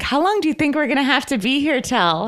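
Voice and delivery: high mock whiny complaint; imitating Kyle. Cohost mimics Kyle on set, high and whiny. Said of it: "she's like".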